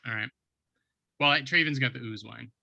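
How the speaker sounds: ordinary talk, nothing marked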